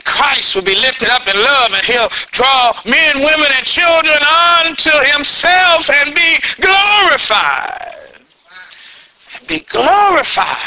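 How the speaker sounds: building to a shouted preach. Acted. Preaching climax, rising shout.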